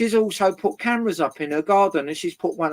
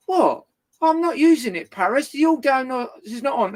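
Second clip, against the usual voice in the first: mock flustered neighbour; 7 semitones higher, 3 dB louder than usual. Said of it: "neighbour quoted defending herself".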